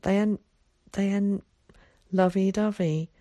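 mildly awkward, halting explanation; embarrassed. A narrator's reading. Boy explains why girls differ, embarrassed. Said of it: "at his own embarrassment".

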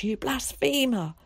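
high, drawn-out outraged cry; screamed accusation. A narrator's reading. Mother condemns son a second time, screaming. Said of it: "she screamed".

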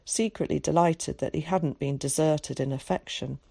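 plain reading voice

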